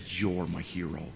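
low, soft, slow affirmation; heartfelt sincerity. Retold or imitated. Pastor honors the humble church planter, heartfelt. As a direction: say it quietly and tenderly.

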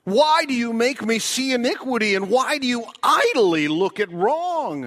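preacher voicing the prophet's loud protest; anguished cry. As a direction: hurl the question at God. Prophet's complaint quoted.

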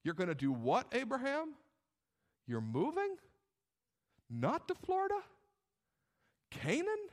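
mock disbelief, questions leaping up very high; incredulous doubters. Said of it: "the voice of the doubters".

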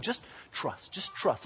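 very soft, half-whispered coaxing; hushed reassurance. Retold or imitated. Counselor walking a kid to the barrel, hushed coaxing. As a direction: soothe under your breath.

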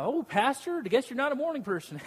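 high, quick teasing jab at the pastor; teasing church guy. Retold or imitated. Church guys teasing the sleepy pastor, high mock voice. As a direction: rib him like a cheeky regular. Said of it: "and they're like".

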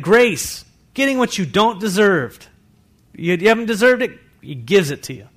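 loud, high, fervent preaching; full-force preaching. Acted. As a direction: preach it out to the whole room. Preaching to congregation.

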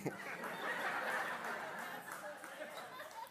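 high breathless unvoiced laugh; real laughter. Real, not acted. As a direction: burst out laughing at your own joke. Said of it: "it shouldn't be that funny".

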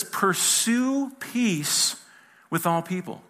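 very slow, drawn-out "Pursue peace", each word weighted; emphatic scripture read. Acted. Pastor proclaims the verse to the church, slow and weighty. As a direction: lean hard on "Pursue" and let it hang.

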